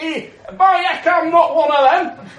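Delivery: loud, high, hammed-up northern English denial; broad mock northern English outburst; northern English accent. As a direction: protest indignantly in a comic northern English accent. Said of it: "he's got a northern accent".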